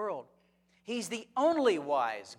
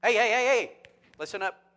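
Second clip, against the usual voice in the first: loud, higher call to wake the class; teacher waking up sleepy students, loud call; 5 semitones higher, 9 dB louder than usual. Retold or imitated. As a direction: rouse dozing students in the back. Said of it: "If I see 'em nodding off in the back".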